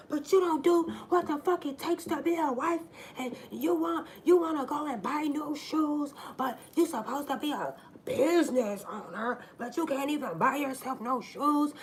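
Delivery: high, loud angry tirade; ranting boyfriend. Retold or imitated. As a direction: keep the rage going at her. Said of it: "And he's like".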